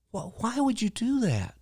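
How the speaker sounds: high-pitched, puzzled question; baffled amazement. Retold or imitated. Barista asking a stranger about his gift, baffled. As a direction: ask it amazed, voice lifted.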